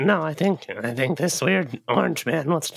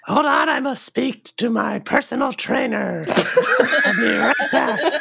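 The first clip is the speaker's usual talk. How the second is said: lightly put-on, higher announcement; posing as Aubrey; 7 semitones higher, 3 dB louder than usual. Impostor excusing themself to the orc, lightly hammed-up higher voice. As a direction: grandly excuse yourself, a little hammed-up.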